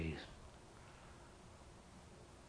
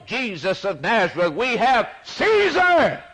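high, loud rejecting shout; jeering crowd; 13 semitones higher, 7 dB louder than usual. Acted. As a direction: yell it as the mob rejecting Jesus. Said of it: "that crowd at Golgotha's hill says".

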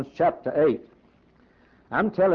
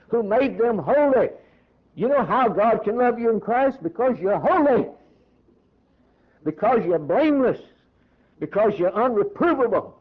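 raised-pitch, building pulpit delivery; fervent preaching; 5 semitones higher than usual. Real, not acted. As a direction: drive each reason home, climbing towards the climax. Preaches at full force.

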